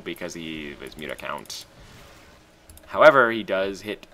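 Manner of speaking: normal talking voice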